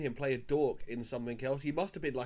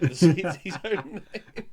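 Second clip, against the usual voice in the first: laughing; 4 semitones higher, 7 dB louder, much slower than usual. Real, not acted. Laughing about Team America joke.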